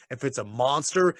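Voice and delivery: ordinary talk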